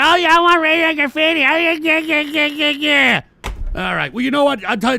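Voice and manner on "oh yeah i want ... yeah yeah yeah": high, fast whiny mockery of fans; moron listeners. Host mocking listeners who want radio graffiti, moronic voice. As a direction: imitate eager idiots in a sustained high drone. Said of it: "all these fucking morons who want radio graffiti".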